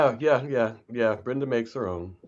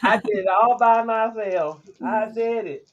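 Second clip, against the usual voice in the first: put-on child voice; 11 semitones higher, 4 dB louder than usual. Quoting a child.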